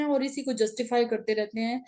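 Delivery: ordinary talk, nothing marked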